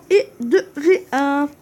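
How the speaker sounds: loud, drawn-out syllable by syllable; emphatic reveal. Real, not acted. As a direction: announce the name slowly and loudly. Excited reveal of a player.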